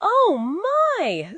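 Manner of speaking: donkey surprised. Acted. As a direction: gasp it in surprise. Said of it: "said Donkey".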